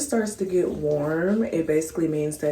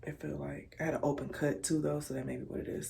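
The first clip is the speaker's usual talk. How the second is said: whispered aside; 9 dB quieter, faster than usual. Real, not acted. Aside about open cut.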